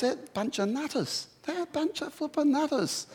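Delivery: high, scornful gossip voice; mock tabloid voice. Preacher mocking tabloid gossip about celebrities, high and scornful. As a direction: sneer it like a tabloid headline. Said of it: "you read in the tabloids".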